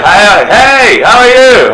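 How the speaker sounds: high, loud mock yell across the road; neighbors yelling. Teacher imitates loud neighbors yelling across the road. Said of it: "really loud neighbors who just yell".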